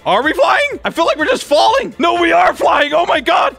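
high, breathless-with-wonder exclaiming; excited. Real, not acted. Gamer marvels to viewers at flying plane, excited high voice.

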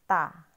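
"Ta." clipped and loud, T not D; teacher repeats "Ta." for the class; Chinese ta. Shown as a demonstration. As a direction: say "Ta." sharply with a hard T. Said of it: "parang letter T".

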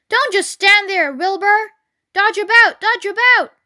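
high, loud cry of command; urgent orders. A narrator's reading. Goose taking command of Wilbur's escape, urgent orders. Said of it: "began to give orders".

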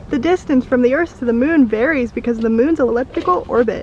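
presenter voice. Acted. Fun fact presenter bit.

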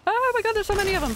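fast, high, loud panic; panicked shout. Real, not acted. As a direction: blurt it out overwhelmed. Swarmed by enemies.

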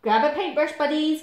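bossy, upbeat Badger; calling out. A narrator's reading. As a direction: call the troops to work. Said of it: "called Badger".

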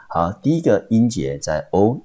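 ordinary delivery

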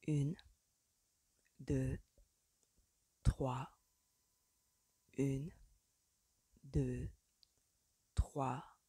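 low, soft counting with long gaps; murmured count. A narrator's reading. Man guiding her feet as they dance, murmured. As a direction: count the steps gently and evenly.

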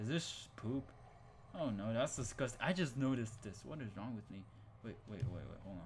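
ordinary talk, nothing marked

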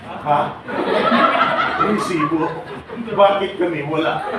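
mock indignant surprise. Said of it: "Sabi ng iba".

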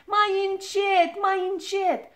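high, loud, drawn-out cry; pained shouting. A narrator's reading. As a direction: yelp at him to be gentle. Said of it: "strigă Ochii Albaștri". (strigăt ascuțit, prelungit)

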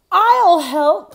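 put-on high, loud child's eager call; a boy jumping in to help; Jack calling out. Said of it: "he called".

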